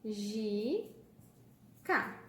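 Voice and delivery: "ma... gi... ca" with long pauses between; split into syllables. Shown as a demonstration. Teacher separating mágica for pupils, syllable by syllable. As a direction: stretch each syllable of "ma, gi, ca" apart. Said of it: "a gente separa em".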